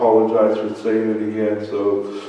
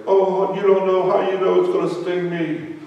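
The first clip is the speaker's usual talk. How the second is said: put-on higher pleading child voice; whiny girl; 9 semitones higher than usual. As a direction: protest like a scared little girl. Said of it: "she was like".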